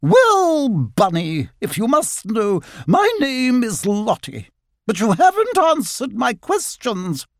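high-pitched, condescending grandeur; haughty otter. A narrator's reading. Otter grandly giving the rabbit her name, haughty.

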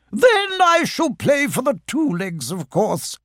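a genteel otter, slow and proud; Lottie the otter. Acted. Lottie defending her legs to Christopher Robin, slow and prim.

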